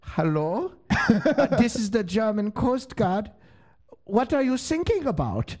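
put-on German accent, high and slow; calm German officer mishearing sinking as thinking; German coast guard. Coast guard answers the sinking ship, mock German accent. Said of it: "hits a button and goes".

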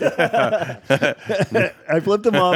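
laughing hard, words stretched slow. Real, not acted. Comic laughing as he retells flipping off heckler.

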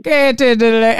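tentative attempt at an Irish accent; clumsy copy of an Irish accent; failed Irish accent. Shown as a demonstration. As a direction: have a go at Irish and miss. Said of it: "I can't do one".

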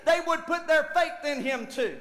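loud, driving sermon delivery; full-force preaching. Acted. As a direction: preach it hard to the congregation, pitch lifted.

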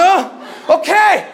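high, loud panicky okay; retold frantic agreement. Hurt boy agreeing to give his hand, frantic yelp.

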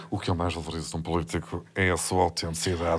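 lightly voiced smug candidate, low and hushed; mock politician voice. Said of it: "e passar três segundos dizer". (imitação trocista de político, muito mais baixo e suave)

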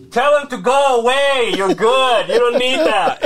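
imitating the uncle egging him on; teasing uncle voice. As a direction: uncle telling him to send the ladies away, high and pushy. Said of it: "my fucking uncle".

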